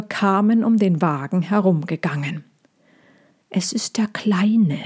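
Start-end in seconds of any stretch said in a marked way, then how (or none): 3.5-4.8 whisper. Acted. Horses hiding in the dark.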